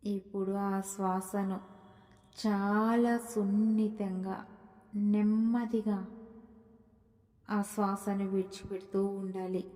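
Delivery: soft, slow meditation voice with long pauses; hushed, unhurried guidance through deep breaths; guided meditation. Acted. Guide leading listeners through deep breaths, soft guided meditation.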